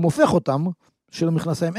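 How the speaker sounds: normal talking voice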